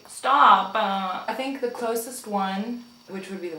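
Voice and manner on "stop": a loud sharp stop; loud shout. Real, not acted. Shouting at the foundation going cray cray, loud stop. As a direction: yell at the runaway product.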